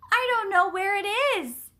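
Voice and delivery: high, loud flustered cry. A narrator's reading. Neighbor cannot find the hose, crying out. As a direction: wail it in a fluster. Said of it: "cried the new neighbor".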